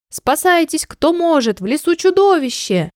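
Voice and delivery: high-pitched storybook cry of alarm; panicked squeak. A narrator's reading. Small animal warns others of a monster, squeaking. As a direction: squeak out a warning, pitched well up. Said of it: "раздался чей-то писк".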